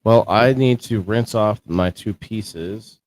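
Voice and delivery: ordinary delivery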